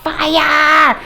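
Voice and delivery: high alarmed shout; retold shout of fire; yelling fire. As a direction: call the alarm through the house. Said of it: "yell out".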